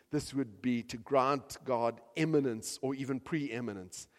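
ordinary talk, nothing marked

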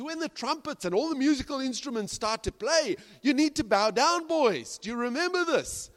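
put-on a king laying down the law to his boys; the king warning the three men to bow; stern king.

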